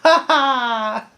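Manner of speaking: high, loud goofy laugh voice; Saitama laugh. Retold or imitated. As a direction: laugh like a carefree hero. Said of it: "Saitama just smiling right there, like".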